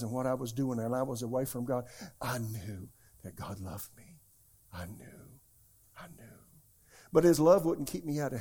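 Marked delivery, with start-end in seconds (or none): 2.2-5.4 hushed. Real, not acted. Pastor recalls being loved. 6.0-6.4 soft breathy whisper; hushed whisper. Real, not acted. Pastor recalls being loved.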